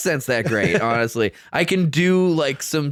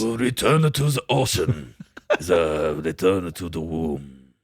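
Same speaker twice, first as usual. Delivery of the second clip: lower, slow mock samurai voice; mock Musashi Miyamoto; 9 semitones lower, slower than usual. Host quoting Cousteau as a mock samurai. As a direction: intone it like a samurai. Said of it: "Musashi Miyamoto".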